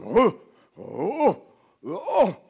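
flustered giant swatting at a weaver; giant dodging. Acted. Giant confused by dodging David, startled grunts. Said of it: "the giant's probably going".

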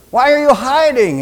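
high, loud demand; God calling insistently. Acted. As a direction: call out to someone hiding. God calls to hiding Adam.

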